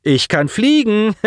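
high, slow, gleeful announcement; delighted boy showing off; laughing boast. A narrator's reading. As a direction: drag it out with glee, pitched high. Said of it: "Colin lachte auf".

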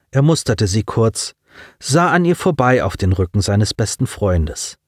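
ordinary delivery

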